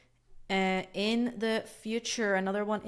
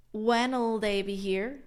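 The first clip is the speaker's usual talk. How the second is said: slow, deliberate "when'll", one syllable; slow contraction; slower than usual. Shown as a demonstration. Teacher slowly spelling out the contracted question. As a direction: stretch "when'll" so learners catch it. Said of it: "It's like".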